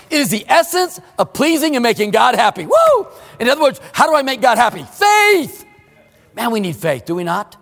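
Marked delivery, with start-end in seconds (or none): 2.6-3.1 joyful whoop. Real, not acted. Preacher exults over God's happiness. 4.8-5.6 exultant cry. Real, not acted. Answers his own question, exultant.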